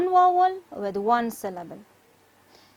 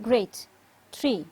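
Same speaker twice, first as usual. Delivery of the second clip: usual speaking voice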